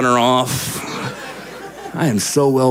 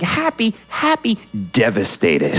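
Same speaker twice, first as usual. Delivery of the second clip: mood swing. Retold or imitated. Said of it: "She's just, like".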